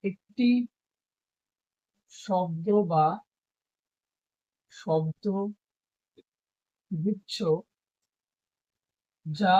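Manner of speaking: slow, word by word, long pauses; teacher dictating a definition; dictation. Shown as a demonstration. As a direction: dictate so students can write it down.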